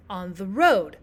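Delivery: "on the road" with the stress on "road"; anapestic stress. Shown as a demonstration. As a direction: pass lightly over "on the", then hit "road". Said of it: "two unstressed syllables that are followed by a stressed".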